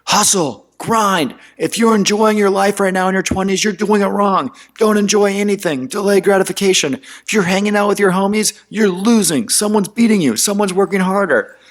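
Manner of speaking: half-hammed motivational screamer; mock hustle-guru yell. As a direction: bark it like an Instagram grind preacher. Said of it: "who are screaming in your face".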